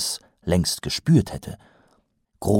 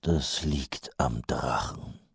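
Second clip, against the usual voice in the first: slow, sorrowful whisper; whispered thought; 5 semitones lower, 9 dB quieter, slower than usual. Acted. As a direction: think it glumly under breath. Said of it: "dachte Mumm kummervoll".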